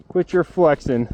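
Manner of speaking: normal talking voice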